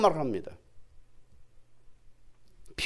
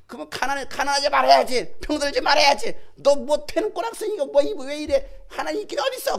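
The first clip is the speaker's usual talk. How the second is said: high, loud scornful tirade; yelled taunt; 9 semitones higher, 9 dB louder than usual. Retold or imitated. Bystander challenging a believer's God, shouted jeer. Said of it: "한번 지르잖아".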